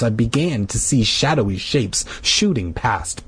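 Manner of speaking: plain reading voice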